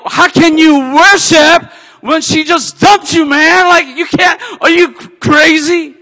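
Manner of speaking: high, loud, scornful shout at himself; mocking inner shout. The devil taunting him after the breakup, loud shout. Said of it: "I go".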